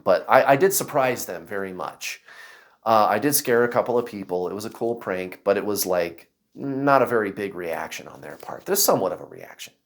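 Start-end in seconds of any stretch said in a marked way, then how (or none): none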